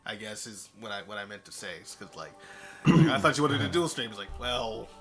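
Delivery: ordinary delivery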